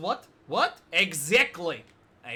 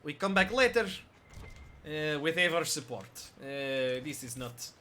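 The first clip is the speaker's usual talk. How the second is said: deliberately bad Russian accent, lower at the end; mock Russian accent; 3 semitones lower than usual. Shown as a demonstration. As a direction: gamer playing a Russian character, hammed up. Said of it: "it's not gonna be a good Russian".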